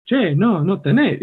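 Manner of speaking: imitating Argentine Spanish; Argentine high voice. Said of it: "that high voice".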